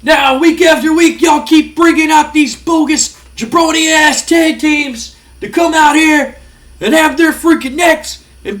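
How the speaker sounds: high, loud shouted wrestling promo; Scott Steiner promo. Retold or imitated. Wrestler grabs the mic and calls out the tag teams, shouting high and loud. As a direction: bellow at the crowd like a heel wrestler on the mic. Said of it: "he grabs the mic and he goes".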